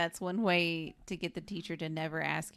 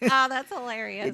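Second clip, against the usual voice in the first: laughing; 7 semitones higher, 9 dB louder than usual. Real, not acted. Laughing at slamming door scene.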